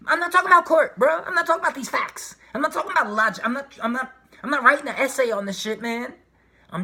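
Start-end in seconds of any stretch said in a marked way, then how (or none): 0.1-4.9 loud, high, exasperated rant; frustrated raised voice; heated shouting. Real, not acted. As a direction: yell it out, fed up with the logic. Heated rant against logic.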